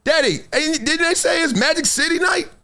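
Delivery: put-on high, shouting little boy's voice; high excited child asking his dad; excited kid son. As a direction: squeal it like a thrilled young son. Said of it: "my son's like".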